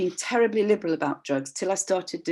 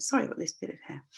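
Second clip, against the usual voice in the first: normal talking voice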